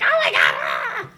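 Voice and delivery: loud hammed-up scream; mock panic, crying out into a scream; freaked out. Woman hearing the voice on the phone again, mock freak-out. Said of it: "really freaked out".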